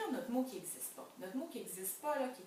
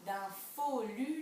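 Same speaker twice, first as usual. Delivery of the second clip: slow, drawn out "follu"; teacher slowly sounds out "follu" for children; slow word sounding; 3 semitones higher, 6 dB louder, slower than usual. Shown as a demonstration. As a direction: stretch "follu" so each syllable is heard. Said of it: "bien écouter les syllabes puis les sons".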